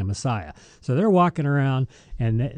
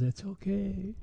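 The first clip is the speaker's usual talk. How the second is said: higher, much softer and unhurried; breezy, no-worries comfort; easygoing reassurance; 7 semitones higher, 12 dB quieter, slower than usual. Retold or imitated. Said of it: "strolls in and says".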